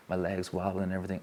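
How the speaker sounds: ordinary talk, nothing marked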